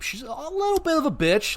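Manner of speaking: high squeaky crone voice; mock old lady voice. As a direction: squeak it like a cranky old woman. Mock voicing of shopkeeper.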